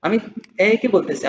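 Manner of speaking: ordinary talk, nothing marked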